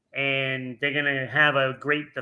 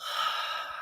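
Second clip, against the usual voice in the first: long, soft exhaled sigh; real sigh; 11 dB quieter than usual. Real, not acted. Co-host before giving his Boston pick, sighing. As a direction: let out a heavy breath quietly.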